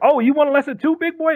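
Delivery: high taunting yell. Retold or imitated. Min yelling up at the tall recruit, high taunt. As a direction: shout the taunt up at him from below.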